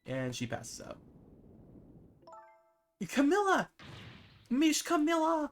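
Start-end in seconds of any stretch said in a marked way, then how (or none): 3.1-5.5 high, loud worried cry; calling out. Acted. Missile calling the fainted Camilla, loud high cry. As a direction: shout her name in alarm. Dog calls fainting girl.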